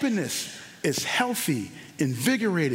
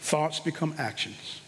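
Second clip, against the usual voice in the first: softer repeat; 8 semitones lower, 6 dB quieter than usual. Shown as a demonstration.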